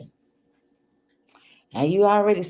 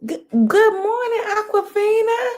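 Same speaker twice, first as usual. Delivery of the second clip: excited greeting. Real, not acted. Greeting a viewer by name.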